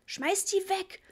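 put-on mother shrieking at her boy to drop the dirty bags; hysterical shout. A narrator's reading. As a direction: shriek it in panic. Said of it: "schrie Mama hysterisch".

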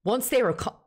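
Scottish-accented story reading. Shown as a demonstration. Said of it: "Read it in a Scottish accent".

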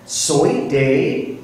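ordinary delivery

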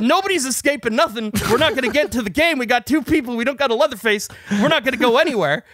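high, exasperated argument; retold heated rant. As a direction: argue back as if losing patience. Acted quarrel, arguing back.

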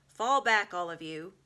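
loud commanding lion; lion ordering the crowd away. A narrator's reading. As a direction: order them back firmly. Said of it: "said Aslan".

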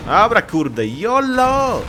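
loud, high hyped yell; excited yolo shout. Real, not acted. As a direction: shout it like a daredevil leap. Gamer hypes before jumping. (głośny, podekscytowany okrzyk)

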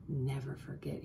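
soft unvoiced whisper; hushed whisper of an old man's promise. A narrator's reading. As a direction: breathe it like a secret. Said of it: "he whispered".

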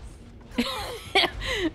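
high squeaky gremlin cackle; squeaky gleeful gremlin giggle. Acted. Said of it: "giving you more gremlin noises".

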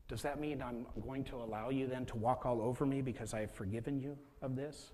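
ordinary talk, nothing marked

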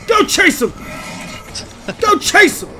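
high, loud urging; excited shout. Real, not acted. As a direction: egg the dog on, yelling. Urging a dog, louder.

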